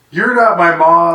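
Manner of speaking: mock outburst; mimicked defiant yell. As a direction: imitate a kid talking back. Listener mimics the boy's yell.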